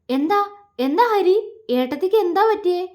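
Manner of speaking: high-pitched, anxious questioning; flustered. A narrator's reading. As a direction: repeat the question in a worried fluster. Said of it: "വെപ്രാളത്തോടെ ചോദിച്ചു". (വെപ്രാളത്തോടെ ചോദിക്കുന്നു)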